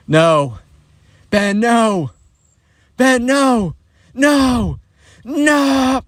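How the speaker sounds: high, stretched-out mock wail; inner horrified plea. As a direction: wail helplessly as if watching disaster unfold. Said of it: "in his head, he's like".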